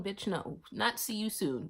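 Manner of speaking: ordinary talk, nothing marked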